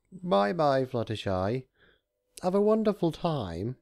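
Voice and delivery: hammed-up high goodbye; sing-song goodbye. A narrator's reading. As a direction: wave her off sweetly. Discord waving goodbye.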